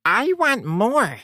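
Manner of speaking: put-on slow, high, greedy child whine; whiny little girl. Greedy child wants food.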